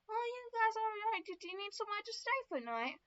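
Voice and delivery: high-pitched American woman; kindly stranger in a high American voice; American accent. Acted. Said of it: "like, American accent for some reason".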